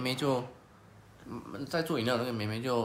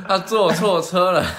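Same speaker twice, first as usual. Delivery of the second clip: slow, much louder words through laughter; laughing loudly; 5 semitones higher, 12 dB louder, slower than usual. Real, not acted. Laughing at the old man taking the wrong car, loud and slow. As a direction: burst out laughing as you say it. Cracking up at the story.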